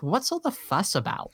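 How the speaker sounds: indignant British reviewer voice; British accent. Acted. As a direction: read it in a hammed-up British accent. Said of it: "read this in a British accent".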